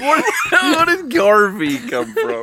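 high, slow, laughing protest; laughing disbelief. Real, not acted. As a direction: exclaim it high in amused disbelief. GM protests the name change, laughing.

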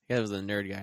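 usual speaking voice

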